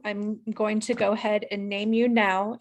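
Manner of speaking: ordinary talk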